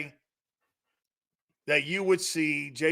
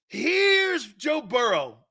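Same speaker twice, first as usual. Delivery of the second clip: higher, slow ring-announcer call; announcer intro; 5 semitones higher, slower than usual. Shown as a demonstration. Host mock-introducing star quarterbacks, announcer style. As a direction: hype each name up. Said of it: "watch this".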